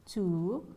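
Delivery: rising tone on "Two"; high rise. Shown as a demonstration. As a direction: let "Two" swing steeply upward at the end. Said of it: "you have the high rise".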